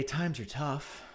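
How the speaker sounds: slow, drawn times are tough; mocking the article; mock dramatic read. Real, not acted. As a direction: read the headline like a sob story.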